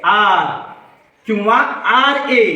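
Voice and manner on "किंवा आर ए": normal talking voice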